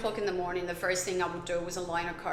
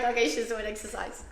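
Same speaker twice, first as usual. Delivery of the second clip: mock passerby voice; 5 semitones higher than usual. Imitating passersby watching her.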